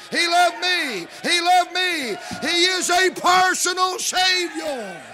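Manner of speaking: loud, high preacher's cry building to a peak; full-force preaching. Real, not acted. Preacher glorying to the congregation that he is loved, full-force preaching. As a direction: shout it out to the congregation in rising fervor.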